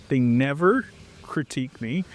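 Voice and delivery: usual speaking voice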